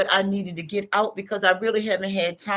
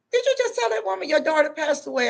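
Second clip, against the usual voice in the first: higher, fast incredulous question; stunned man asking; 6 semitones higher, faster than usual. Retold or imitated. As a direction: ask it in shocked disbelief, quick and high. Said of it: "And the man say".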